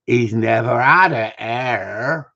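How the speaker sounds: put-on broad Somerset accent, drawn out; deep Somerset voice. Retelling the panel judge's line in a Somerset accent. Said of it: "in that deep Somerset voice".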